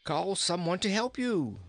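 put-on high, chuckling old king; cheerful king stuck in a well; jolly king voice. King speaks.

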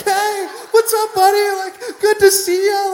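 loud, very high drawn-out squeal; comic high-pitched gushing; overexcited greeting. Retold or imitated. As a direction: holler hello slowly in a squeaky voice, hammed up. Retold overexcited greeting walking in.

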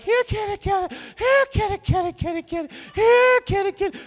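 very high, sing-song calling of a cat. Retold or imitated. Calling a cat from across the room, very high sing-song. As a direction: coax the kitty over in a high calling voice. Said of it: "You're like".

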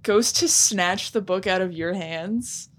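ordinary talk

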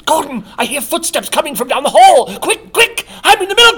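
high loud frantic whisper-plea; panic, begging to be hidden; panicked. Acted. Milkmaid panics at footsteps.

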